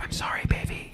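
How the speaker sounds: soft whispered apology in the ear; mock tender whisper into an ear. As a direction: whisper it close like soothing a lover. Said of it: "walk up to your ear like".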